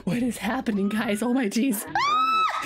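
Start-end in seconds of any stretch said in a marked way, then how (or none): 1.9-2.6 very high-pitched scream; panicked shriek. Real, not acted. Player losing control in game, high panicked shriek. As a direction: shriek in sudden alarm.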